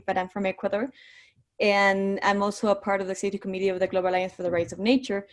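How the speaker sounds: usual speaking voice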